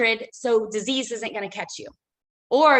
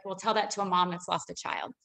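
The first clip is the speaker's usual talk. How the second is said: ordinary talk, nothing marked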